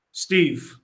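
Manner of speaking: normal talking voice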